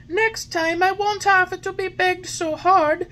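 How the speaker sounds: put-on high, loud happy boy voice; cheerful puppet. A narrator's reading. Puppet cheering to the fairy, full of life. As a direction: bounce with new life.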